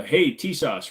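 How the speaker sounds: ordinary talk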